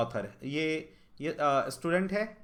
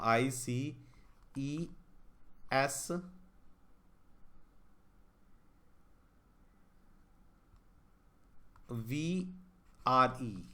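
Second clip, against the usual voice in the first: letters drawn out one by one, softer; spelling out slowly; 2 semitones lower, 5 dB quieter, much slower than usual. Shown as a demonstration. Teacher spells letters slowly while writing the jumbled word. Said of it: "जैसे मैं एक बार ऐसे लिख देता हूँ".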